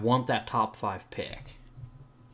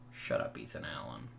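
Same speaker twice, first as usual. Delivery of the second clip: hushed, breathy shut up under the breath; whispered brush-off; 11 semitones lower, 9 dB quieter than usual. Real, not acted. Manager whispering at his player Ethan Allen, annoyed hush. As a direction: mutter it so no one hears, dismissive.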